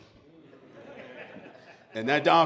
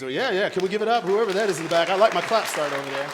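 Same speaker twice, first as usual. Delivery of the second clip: hyping the crowd; 4 semitones higher, 7 dB louder than usual. Real, not acted.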